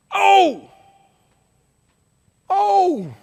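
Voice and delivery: high, loud held cry; yelling. Acted. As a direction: holler the word out long. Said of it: "he starts yelling".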